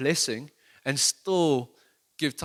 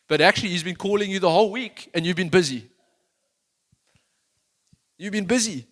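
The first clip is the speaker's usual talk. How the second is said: loud, higher passionate preaching; preaching at full force; 7 semitones higher, 9 dB louder than usual. Acted. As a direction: drive the point home from the pulpit.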